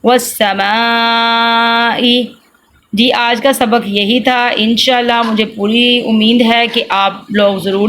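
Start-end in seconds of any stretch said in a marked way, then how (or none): 0.0-2.0 والسماء with "maa" held very long before the short ending; madd, lengthened vowel. Shown as a demonstration. Teacher spelling out the madd, very drawn-out vowel. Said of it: "میم زبر الف مد ما".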